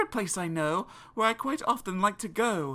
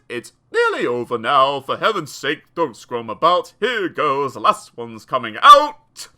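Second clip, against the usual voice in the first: low, loud gruff doctor voice; dentist's deep voice to a squirming child; 8 semitones lower, 8 dB louder than usual. Acted. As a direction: push on through it. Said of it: "He said".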